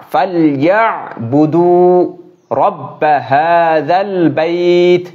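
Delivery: slow melodic chant of the verse; tajweed recitation; Quran recitation. Acted. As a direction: sing out the verse, long and held.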